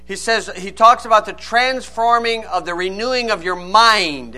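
usual speaking voice

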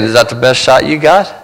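a quiet dare; quiet defiance. Acted. Jesus on the cross taunting the devil, quiet defiance.

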